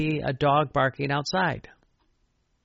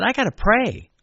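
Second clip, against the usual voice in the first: higher, louder retold outburst; retelling a frustrated decision, pitched up and loud; exasperated resolve; 8 semitones higher, 6 dB louder than usual. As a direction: burst out as if at your wit's end. Fed up with never finishing list.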